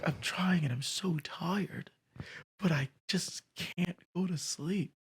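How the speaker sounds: high, breathy whisper, very slow; soft high mock of a wiped-out roommate; imitating the roommate. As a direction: play the exhausted roommate barely audible. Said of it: "But this is exactly how he talks".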